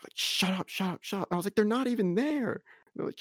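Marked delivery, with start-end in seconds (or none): 0.1-1.3 mock shushing. Friends hushing them. 1.6-2.6 pitched way up, incredulous; mock protest to friends, pitched way up; high mock protest.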